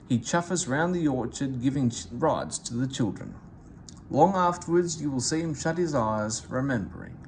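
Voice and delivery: ordinary delivery, nothing marked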